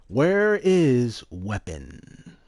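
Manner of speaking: slow drawn-out. Acted. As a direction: drag out WHERE slowly and loud.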